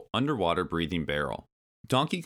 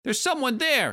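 high, louder little creature shout; 5 dB louder than usual. A narrator's reading. Kremling spotting an intruder, high shouted alarm. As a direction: squeal the alarm as a tiny henchman. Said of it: "he shouted".

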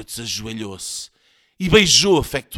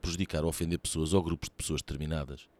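fast, low, soft legal small print; rapid, subdued announcer read; disclaimer read; 6 semitones lower, 8 dB quieter, much faster than usual. Real, not acted. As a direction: rattle off the disclaimer. Reads programme disclaimer.

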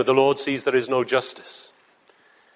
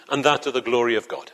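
usual speaking voice

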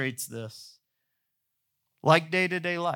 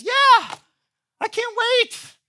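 much higher, louder gushing neighbor voice; eager neighbor; 5 dB louder, slower than usual. Retold or imitated. Neighbor accepting the ice cream invite, high excited gush. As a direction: squeal it like an overeager neighbor.

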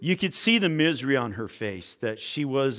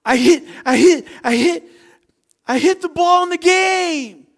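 high, loud retold cry of triumph; crying boy's outburst; 12 dB louder than usual. Little boy tells his coach about the game, high crying-excited shout. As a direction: shout it haltingly like an overcome kid, not really crying. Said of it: "And he's crying".

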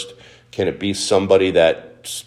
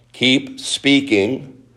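slow urging; 5 semitones higher, much slower than usual. Acted. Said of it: "preacher urging go on speaking".

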